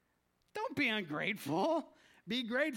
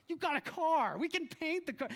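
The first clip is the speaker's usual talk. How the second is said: higher-pitched mock pleading father; protesting dad; 8 semitones higher than usual. Dad answering his daughter's complaint about the car, pleading.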